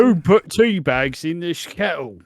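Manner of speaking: a hint of Grant fussing over the kettle; imitating Grant. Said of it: "my favourite thing is Grant being like".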